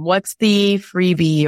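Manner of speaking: ordinary talk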